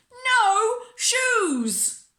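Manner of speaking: put-on high, loud child's tantrum yell; shouting. A narrator's reading. Bella has pulled off her shoes, shouting in protest. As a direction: yell it like a stubborn toddler.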